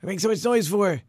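storyteller acting an exasperated shout; yelling. A narrator's reading. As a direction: yell at noisy children to stop. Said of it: "he yells at them".

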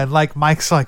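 usual speaking voice

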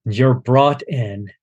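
ordinary talk, nothing marked

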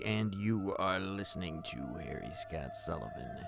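usual speaking voice

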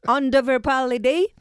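imitating an actress's garbled foreign-accented Quebec French; mock bad Québécois accent. Columnist mocking the actress from the clip, bad Québécois accent. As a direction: mimic clumsy foreign-accented French as a puzzled question. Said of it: "On va écouter comment elle parle français".

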